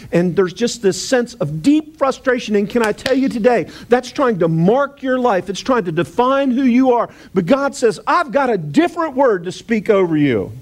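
preacher climbing to a fervent peak; preaching at full force. Acted. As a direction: build the congregation up to God's promise.

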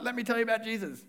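quick, high-pitched pushy pitch; pushy preaching. Pretend. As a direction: barge in preaching at them. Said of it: "come in all guns blazing".

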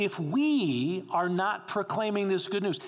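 ordinary delivery

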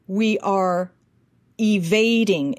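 put-on light Ukrainian accent on "evading"; lightly imitating a Ukrainian speaker; mock Ukrainian accent. Coach showing how Americans misheard Zelensky, Ukrainian accent. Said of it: "it sounds like you said".

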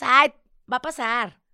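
loud, drawn-out sing-song brush-off; sarcastic mock comfort; mock dismissive. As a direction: mimic the hollow "it'll pass" with scorn. Mocking friends' empty comfort.

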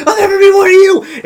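very high, loud frantic shout; mock screaming. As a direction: scream it as a defiant character. Screaming refusal while cutting claw.

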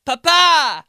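loud, high Italian Papa; Italian accent. Retold or imitated. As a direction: cry it out Italian-style. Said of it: "start being all Italian".

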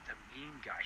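soft, sinking repeat of the title; muttered in dread. Retold or imitated. To himself after the fan leaves, quiet dread. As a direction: let it sink in quietly. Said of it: "I was like".

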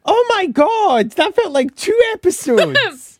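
high, slow disbelief; shocked exclamation. Real, not acted. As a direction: gasp it out, stunned. Exclaiming at the long episode.

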